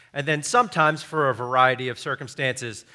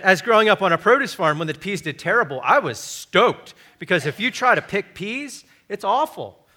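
ordinary delivery

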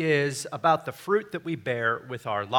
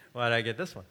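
softer, lower private wondering; inner grumble; 5 semitones lower, 6 dB quieter, much faster than usual. Retold or imitated. Preacher replaying his thought on an assigned topic, quiet musing. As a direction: muse to yourself, softly. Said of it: "in the back of my mind".

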